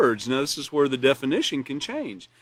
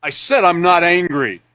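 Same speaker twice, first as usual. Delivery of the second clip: loud, forceful "I'm not angry" with stress on said; angry denial; 4 semitones higher, 8 dB louder than usual. Shown as a demonstration. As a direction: snap it out like you're ready to fight. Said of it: "He's ready to fight".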